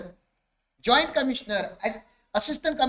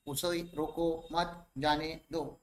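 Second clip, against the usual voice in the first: low, soft and slow, each word of "उसे रोको मत जाने दो" separate; neutral word-by-word reading; 6 semitones lower, 7 dB quieter, slower than usual. Shown as a demonstration. Teacher reading the ambiguous sentence to students, slow and word by word. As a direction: read "उसे रोको मत जाने दो" evenly, favouring neither meaning. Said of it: "इसको दो तरीके से पढ़ा जा सकता है".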